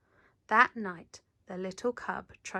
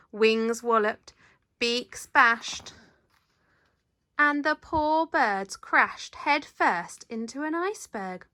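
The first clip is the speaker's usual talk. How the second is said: usual speaking voice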